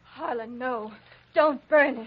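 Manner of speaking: alarmed plea. Acted. Woman cries out to stop Harlan burning the map, alarmed. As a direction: stop him before it's too late.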